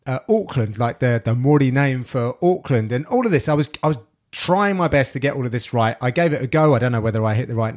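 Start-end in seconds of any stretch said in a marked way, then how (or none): 1.4-1.7 "Morty" for Maori with a lightly rolled R; "Morty" with long aw and flicked R; native-style pronunciation. Shown as a demonstration. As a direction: say "Morty" as MAW-dee, tapping the R. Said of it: "You kind of roll the R a little bit".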